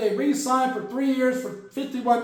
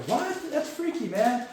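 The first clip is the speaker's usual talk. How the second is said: mock onlookers; 4 semitones higher, 5 dB quieter, slower than usual. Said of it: "And they're like".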